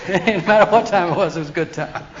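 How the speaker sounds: laughing through the line. Real, not acted. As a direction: chuckle it out as you retell the joke. Laughing at Ralph's saying.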